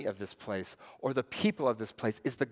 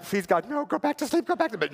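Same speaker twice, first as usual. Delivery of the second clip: higher, half-joking desperate plea; mock pleading; 7 semitones higher than usual. Tired parent in bed at night, mock pleading. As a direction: beg the baby to sleep, hammed up.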